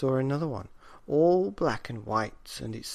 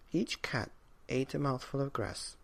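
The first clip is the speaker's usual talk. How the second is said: ordinary delivery, nothing marked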